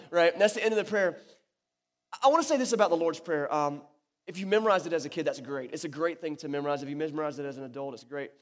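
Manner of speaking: ordinary talk, nothing marked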